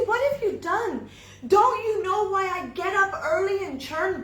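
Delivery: loud, high, incredulous telling-off; exasperated scolding. Acted. Wife scolds her foolish husband, high and loud. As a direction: berate him for sending Krishna away, shouting.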